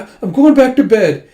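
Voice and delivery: high, loud, embarrassed excuse; flustered escape. Retold or imitated. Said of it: "she just blushes with steam".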